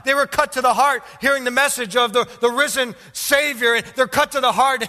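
high, loud, fervent sermon storytelling; full-force preaching. Real, not acted. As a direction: drive the story home from the pulpit at full force.

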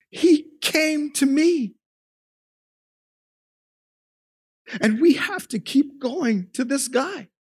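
high, loud, urgent preacher voice; impassioned preaching. Acted. Preacher to congregation about a destitute man, high and urgent. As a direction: lift the congregation with rising fervor. Preacher retells with rising intensity.

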